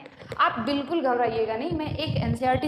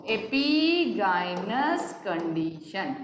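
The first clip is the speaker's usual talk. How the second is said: "epigynous" stretched very slowly, syllable by syllable; very slow dictation; 2 semitones lower, much slower than usual. Shown as a demonstration. Teacher repeating the term for students to write, very slow. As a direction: spell "epigynous condition" out slowly.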